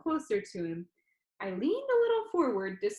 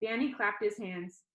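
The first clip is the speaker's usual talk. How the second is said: ordinary delivery, nothing marked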